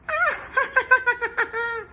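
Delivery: high cackle. Acted. Witch appears.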